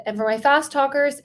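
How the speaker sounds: ordinary talk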